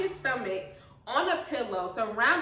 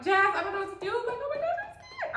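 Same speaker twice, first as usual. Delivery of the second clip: high-pitched panic, climbing to a squeal; retold panicked screaming; 13 semitones higher, 3 dB louder, faster than usual. Mom retelling call to sister, imitated panicked screaming. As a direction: imitate yourself wailing to a sister. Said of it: "I'm, like, screaming".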